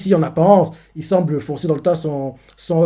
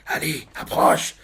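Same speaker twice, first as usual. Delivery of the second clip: chuchoté; 3 dB quieter than usual. Retold or imitated. Pensée menaçante de l'héritier.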